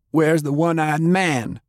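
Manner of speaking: put-on high-pitched demand from a girl; mild shout. A narrator's reading. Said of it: "Faye shouted".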